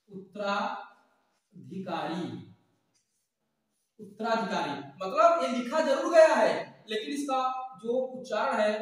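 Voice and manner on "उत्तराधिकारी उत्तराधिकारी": "उत्तराधिकारी" drawn out syllable by syllable; slow, careful repeat. Shown as a demonstration. Teacher slowly repeats the meaning for students, drawn out. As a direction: spell it out slowly so students catch it. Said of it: "इसका मतलब उत्तराधिकारी".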